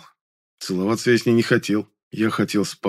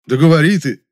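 loud, higher impatient outburst; raised voice, indignant and impatient; 7 semitones higher, 8 dB louder than usual. A narrator's reading. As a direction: burst out, exasperated. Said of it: "возмутился я".

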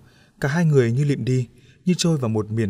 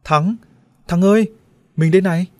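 very loud, much higher shout; 7 semitones higher, 8 dB louder than usual. Acted. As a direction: yell to him across the crowd. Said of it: "gọi to". (hét gọi thật to)

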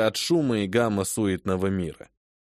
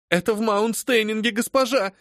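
frightened cry; 10 semitones higher than usual. Acted. Phoebe crying to her mistress that the fire is close. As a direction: cry it out in alarm. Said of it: "кричала Феба Маркс".

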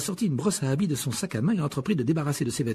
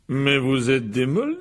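slow exclamation of wonder; delighted; 3 semitones higher, 4 dB louder, slower than usual. Acted. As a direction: marvel at them, drawing it out. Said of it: "s'exclama Monsieur Weasley avec ravissement". (ravissement émerveillé)

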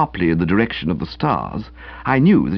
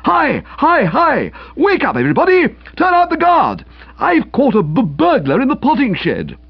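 high, loud breathless yelling; shouting alarm; 4 dB louder than usual. Acted. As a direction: raise the alarm at full voice. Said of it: "giving tongue in the darkness".

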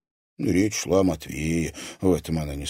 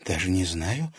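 ordinary delivery, nothing marked

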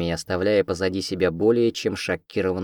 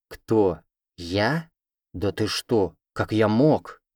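mock surprise; much slower than usual. A narrator's reading. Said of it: "натурально удивился он".

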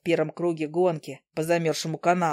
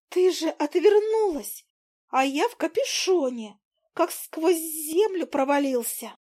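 much higher, slow tiny voice; a small fly making excuses; defensive fly; 8 semitones higher, slower than usual. Acted. The fly explaining to Izi, high and slow. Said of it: "она развела лапками".

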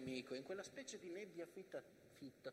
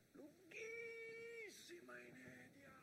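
ordinary talk

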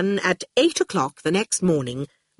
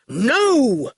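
higher, drawn-out angry no; flat refusal; 6 semitones higher, 3 dB louder than usual. A narrator's reading. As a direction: cut them off angrily. Quentin cuts Archer off.

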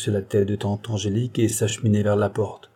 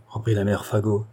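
ordinary delivery, nothing marked

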